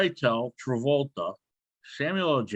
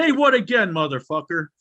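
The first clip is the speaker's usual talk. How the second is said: Samuel L. Jackson. Retold or imitated. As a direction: bark the famous movie line. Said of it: "Man, that Samuel L. Jackson".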